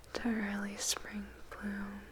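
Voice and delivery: ordinary delivery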